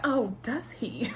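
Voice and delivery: slow, mock-interested sarcasm; drawn-out skeptical question; sarcastic. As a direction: imitate a woman feigning surprise. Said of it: "started talking in a sarcastic voice".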